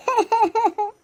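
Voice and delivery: short sly laugh; mocking laugh. Daughter-in-law laughs at mother-in-law's menu, mocking laugh. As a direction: laugh at her behind a smile. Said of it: "तुम हंस क्यों रही हो?".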